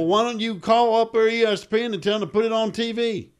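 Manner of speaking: high, loud Texas caller; Texas accent. Retold or imitated. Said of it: "I'm doing a Texas accent".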